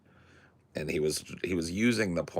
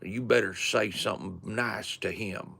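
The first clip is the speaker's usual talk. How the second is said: grandma voice; 6 semitones higher than usual. Retold or imitated. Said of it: "his grandma was like".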